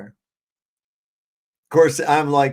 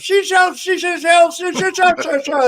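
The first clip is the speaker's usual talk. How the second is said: high, loud, stuttering repeat of "she sells"; imitating a gleeful hypnotist stuck on a phrase; stuck hypnotist loop; 7 dB louder than usual. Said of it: "and he goes".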